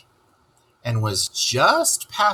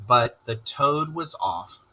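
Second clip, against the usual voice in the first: usual speaking voice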